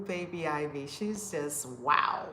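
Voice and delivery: normal talking voice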